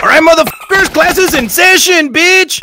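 loud, high mock teacher opening class; high, loud parody of a teacher calling class to order. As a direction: bark class open like a rowdy teacher. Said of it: "today's lesson".

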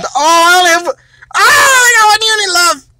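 laugh-cry it, hamming up the loss; mock crying with laughter. Dad down to one unit, laughing mock sob.

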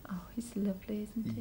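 soft unvoiced whisper. Real, not acted. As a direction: whisper it aside. Whispered aside after story.

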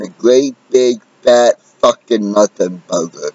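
usual speaking voice

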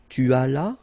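"tu allas" as tu ala, no S; loud, clear "tu allas" ending on a; silent final S. Shown as a demonstration. Teacher modelling a verb form, silent final S. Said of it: "final S is not pronounced".

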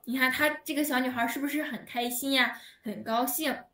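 normal talking voice

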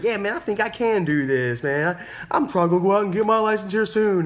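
high, confident retold boast; growing confidence. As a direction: imitate someone newly believing in himself. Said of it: "starting to believe in himself".

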